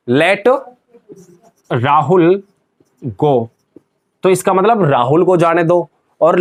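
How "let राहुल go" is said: long pauses between each word; word-by-word dictation. Shown as a demonstration. Teacher writing out an example for students, word-by-word dictation. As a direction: dictate it slowly, one word at a time.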